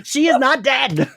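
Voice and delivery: high, laughing objection; laughing protest. Real, not acted. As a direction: protest through real laughter. Mock protest at the teasing.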